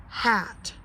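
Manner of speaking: plain reading voice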